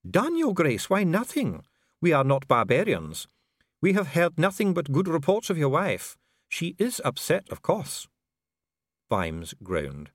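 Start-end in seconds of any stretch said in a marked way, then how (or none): 0.1-8.1 higher, smooth and coolly polite; a bureaucrat soothing a prisoner; calm official Dee. A narrator's reading. Dee answering imprisoned Vimes, coolly polite.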